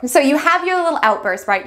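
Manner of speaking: ordinary talk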